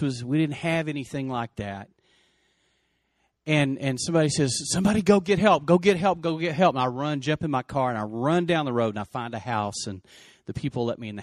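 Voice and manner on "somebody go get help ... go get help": raised, higher voice, imitating a bystander; urgent call for help. Bystander sending someone for help, retold urgent call. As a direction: call out urgently over the crash scene.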